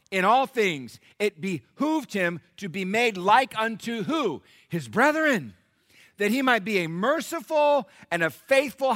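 loud, high, driving sermon delivery; full-force preaching. Acted. Pastor preaching hard to his congregation, full force. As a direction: preach it out to the whole church.

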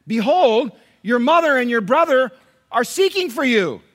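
high, loud crowd calling to someone. A narrator's reading. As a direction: call it out loudly over a crowd. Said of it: "they were calling unto him".

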